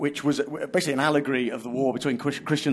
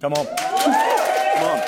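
excited cheer; 5 dB louder, much slower than usual. Real, not acted. Host cheering after recital.

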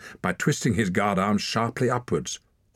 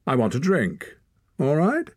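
high, raised, testing; boy's defiant request; 8 semitones higher, 3 dB louder than usual. A narrator's reading. Torak asks Hord for a drink, high and pointed. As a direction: ask it pointedly.

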